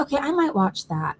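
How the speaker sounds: ordinary talk, nothing marked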